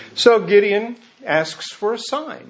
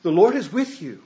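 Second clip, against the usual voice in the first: ordinary talk, nothing marked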